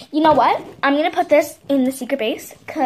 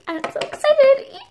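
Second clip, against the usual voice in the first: much higher, louder excited squeal; 11 semitones higher, 6 dB louder than usual. Pretend. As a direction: squeal it with glee. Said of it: "when I'm excited, I talk like this".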